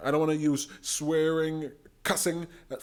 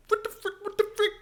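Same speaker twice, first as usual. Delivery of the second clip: 1 high incredulous squeal; faster than usual. Real, not acted. Disbelief at clip.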